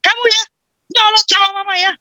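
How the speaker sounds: high sharp scolding mom; scolding mother. Retold or imitated. A mother scolding her child for talking back, shrill. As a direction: snap at the kid like a cross mom.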